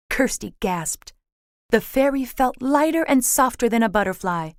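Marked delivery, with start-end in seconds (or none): none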